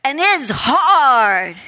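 drawn-out, high, loud whine; mock complaint. Woman complains to the teacher that it's hard, mock whine. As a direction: imitate her moaning, stretched out slowly.

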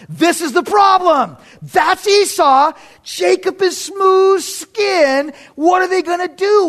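loud, high, drawn-out exclamation; emphatic preaching shout. Real, not acted. Preacher shows the congregation the brothers' difference, shouting emphatically. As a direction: cry out the problem, stretching the words. Preacher dramatizes the story's problem.

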